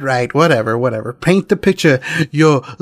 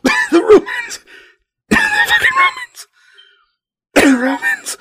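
laughing. Real, not acted. Mocking Romans through laughter.

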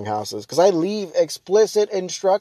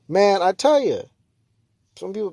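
ordinary delivery

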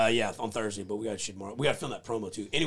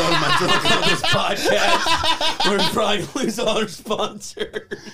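laughing sign-off; 8 semitones higher, 7 dB louder, much slower than usual. Real, not acted. Laughing while signing off.

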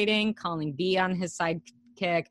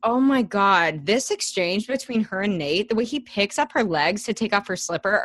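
ordinary talk

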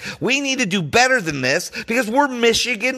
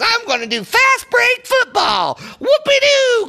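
mocking the coach. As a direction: ham up the coach's cocky plan, shouted high. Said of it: "Richie Rod Rodriguez".

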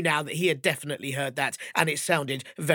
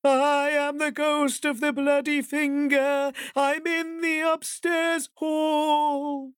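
higher, slower ghost wail drawing near; walking closer; 12 semitones higher, 4 dB louder, slower than usual. Acted. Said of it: "it was coming even closer".

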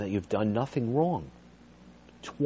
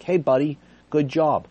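upbeat praise; 9 semitones higher, 7 dB louder than usual. Shown as a demonstration. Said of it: "saying, 'Hey, good job,'".

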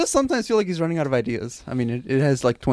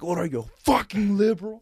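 mock primal rage; 6 semitones higher than usual. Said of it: "Just like".

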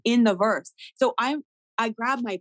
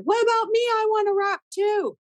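much higher, a bit louder, a squeaky little plea; high put-on voice; 14 semitones higher, 3 dB louder than usual. On stage with the mic, begging the DJ in a tiny high voice. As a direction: pipe it up high like a small girl asking. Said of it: "In that voice".

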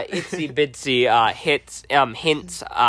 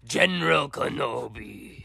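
slow, softer robotic growl; General Grievous voice; 5 dB quieter, slower than usual. Acted. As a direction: rasp it out like the cyborg general. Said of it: "General Grievous impersonation".

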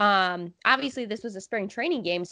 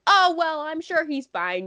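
pitched-up, lightly voiced old-timer; a hint of breezy old-fan reassurance; imitated old fan; 6 semitones higher than usual. Old fans shrugging off the injury, lightly imitated. Mimics older fans' reassurance.

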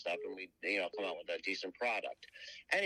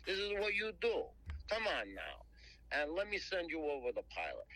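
put-on high, coaxing New Jersey accent; Jersey guy; 7 semitones higher than usual. Jersey caller pitching the Turtles deal, high coaxing Jersey accent. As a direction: sell it hard like a New Jersey deal maker. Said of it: "he talks just like a guy from Jersey".

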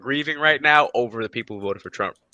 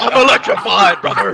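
slow, louder wrestling promo voice; 4 semitones higher, 4 dB louder than usual. Retold or imitated. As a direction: hype it like a pro wrestler. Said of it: "Wrestling promo".

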